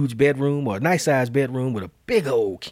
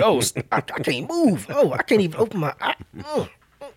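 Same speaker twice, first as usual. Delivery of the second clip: panic; 7 semitones higher than usual. Retold or imitated. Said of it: "I'm like".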